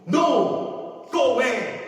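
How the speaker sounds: shouting at Satan to leave; commanding shout. Pretend. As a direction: drive the devil off with a shout. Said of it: "영어로 얘기했어요".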